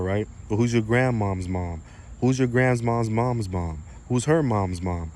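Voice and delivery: normal talking voice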